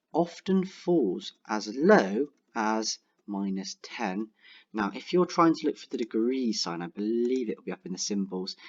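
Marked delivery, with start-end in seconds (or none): 0.1-4.3 slow dictation. Shown as a demonstration. Dictating while typing.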